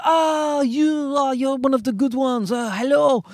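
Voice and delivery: high, fluttery welcoming voice; gushing French approval. Retold or imitated. Comic imagines French people approving of his wife, high fluttery coo. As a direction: coo like a delighted Parisian. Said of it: "they'd be like".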